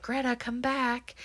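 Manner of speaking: high, soft imitation of a creepy man; creepy British man. Film fan imitating the masked man calling Greta, creepy British voice. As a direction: put on a soft, creepy British man's call. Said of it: "like, a really creepy, like, grown man's, like, British voice".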